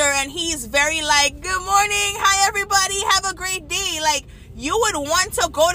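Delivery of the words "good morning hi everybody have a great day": high, bubbly hello to everyone; cheerful janitor. Retold or imitated. Janitor greeting students as they arrive, cheerful and high. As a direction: call out an upbeat morning welcome.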